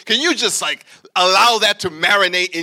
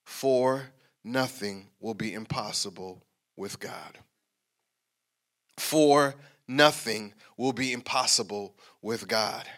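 slow scripture reading; 9 semitones lower, 8 dB quieter, slower than usual. Shown as a demonstration. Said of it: "This is the type of stuff I do when I'm reading scripture".

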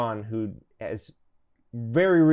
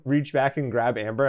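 normal talking voice

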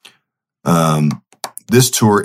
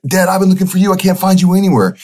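much higher, echoing delight; son excited; 12 semitones higher, faster than usual. Retold or imitated. Son answering his dad in the dream, high and excited. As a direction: throw the greeting back just as thrilled. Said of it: "I'm like".

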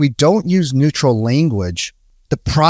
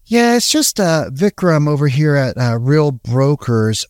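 curious and slow. Shown as a demonstration. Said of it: "Very curious, very slow".

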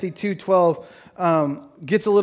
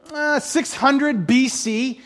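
ordinary delivery

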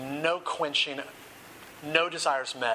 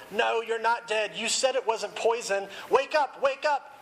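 desperate pleading; 6 semitones higher than usual. A narrator's reading. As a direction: rise into panic on wake up. Harry shaking dying Dumbledore.

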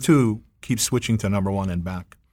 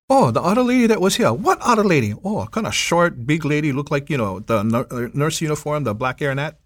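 driver answering; 5 semitones higher than usual. Retold or imitated.

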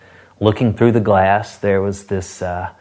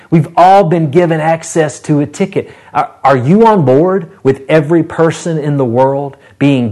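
high, loud preaching to the whole congregation; fervent preaching; 6 semitones higher, 4 dB louder than usual. Acted. Pastor urging his congregation, preaching hard and loud. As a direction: rouse the church to board the ticket.

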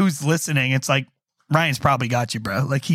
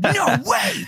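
high shouted disbelief; surprised shout. Retold or imitated. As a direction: yell it in total shock. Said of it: "I was like".

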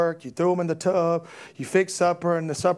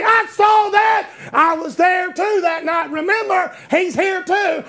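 shouted much higher and louder, first words slow; preacher voicing God at full shout; God's voice; 12 semitones higher, 5 dB louder, slower than usual. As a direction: boom it out as God declaring he saw. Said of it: "God will say".

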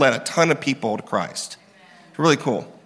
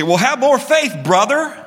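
preachy dismissive; 7 semitones higher than usual. Acted. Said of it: "you don't need to say".

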